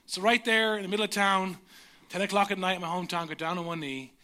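ordinary talk, nothing marked

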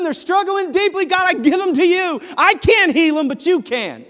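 loud, high, pouring it out to God; shouted prayer. Shown as a demonstration. Pastor shows how to shout cares to God from the car, loud. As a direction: cry it out to the Lord at full voice.

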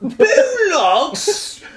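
very high, squawked English-accent attempt; bad English accent. Host attempting bollocks for the others, squawky English accent. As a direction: squeal it as a questioning cartoon Brit. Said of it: "How would you say bollocks".

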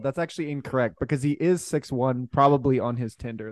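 usual speaking voice

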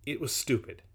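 ordinary delivery, nothing marked

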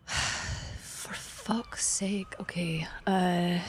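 quiet, whispered grumble to oneself; exasperated mutter. Acted. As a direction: sigh it out softly, barely voiced. Weary reading of notice board.

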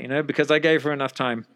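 ordinary talk, nothing marked

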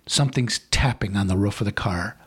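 ordinary talk

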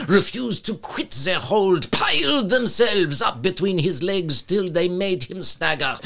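high, loud mock French accent. Shown as a demonstration. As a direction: read it in a hammed-up French accent. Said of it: "If you will excuse my French accent".

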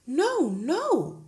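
cried. Acted. Teacher crying out to the headmistress. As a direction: cry out in protest. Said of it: "cried Miss Honey".

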